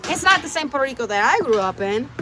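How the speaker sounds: ordinary talk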